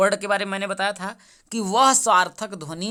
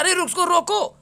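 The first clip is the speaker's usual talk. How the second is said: loud, high shout to stop someone; urgent calling out; 11 semitones higher, 9 dB louder than usual. Acted. Teacher acting out stopping a person walking off, urgent shout. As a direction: call out sharply as if halting someone.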